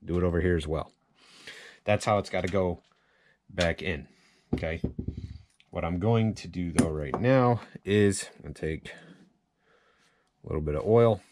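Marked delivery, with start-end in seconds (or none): none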